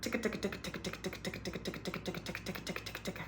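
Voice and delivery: ticka ticka said fast and very soft with a light tongue flick; quick quiet ticka ticka; ticka tongue drill. Shown as a demonstration. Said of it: "wake up the tongue doing that".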